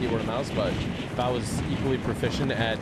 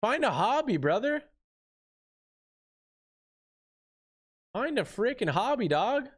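exasperated scolding; 9 semitones higher than usual. Real, not acted. Scolding the attacker.

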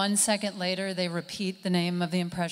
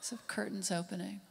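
very soft setup fading to a whisper; hushed announcement; 18 dB quieter, faster than usual. Acted. Comic introduces curtains impression to audience, hushed. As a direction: announce it in a reverent hush.

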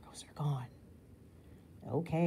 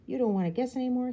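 higher, fast playful taunt; grinning tease; 9 semitones higher, 7 dB louder, faster than usual. A narrator's reading. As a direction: tease them with a grin. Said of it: "he grins".

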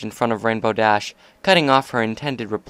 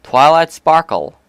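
loud, slow, higher proclamation; grand announcement; 6 semitones higher, 9 dB louder, slower than usual. A narrator's reading. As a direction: announce her like a star. Announcing her name to the room.

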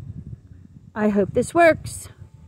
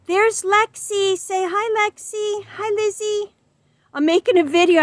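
higher, playful storybook voice; talking book voice; 8 semitones higher than usual. Acted. Book character introducing Lexi to the kids, higher voiced. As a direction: play a cheerful little book. Parent voicing the second book.

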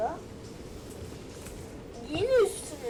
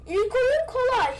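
higher, louder triumphant boast; excited brag; 8 semitones higher, 7 dB louder than usual. Real, not acted. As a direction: crow about the easy win. Boasting after win.

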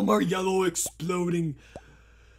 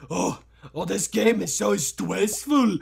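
ordinary talk, nothing marked

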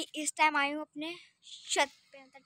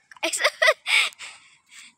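a high, loud burst of laughter; 10 semitones higher, 9 dB louder than usual. Real, not acted. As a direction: laugh out at yourself. Laughs at her own lisp.

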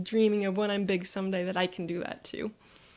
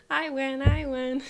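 higher, louder gloating chant; triumphant child; 5 semitones higher, 6 dB louder, slower than usual. Retold or imitated. Child gloats to sister, triumphant. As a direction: crow it like a winning kid. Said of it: "And I go".

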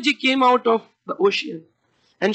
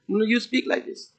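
normal talking voice

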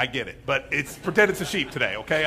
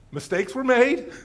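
high, slow, drawn-out non-admission; mock evasive politician; 5 semitones higher, 4 dB louder, slower than usual. Politician caught in wrongdoing dodging blame, mock evasive. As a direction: dodge the blame with mock innocence.

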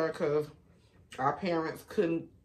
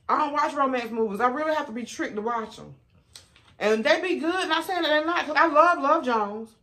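excited; 7 semitones higher, 5 dB louder than usual. Real, not acted. Animated about romance movies.

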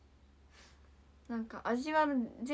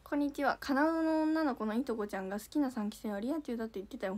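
ordinary talk, nothing marked

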